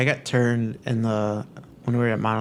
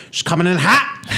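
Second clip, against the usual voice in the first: raised, higher laughing call-out; mock warning call; 5 semitones higher, 4 dB louder than usual. Mimics everyone calling a warning.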